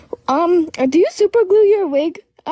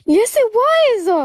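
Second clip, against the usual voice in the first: drawn-out, higher-pitched protest; indignant retort; 6 semitones higher, much slower than usual. Real, not acted. Guest insists to host the ASMR was real, indignant. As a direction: stretch it out in stubborn contradiction.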